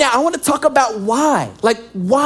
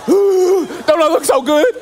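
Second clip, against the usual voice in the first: deranged runner; 4 semitones higher, slower than usual. Pretend.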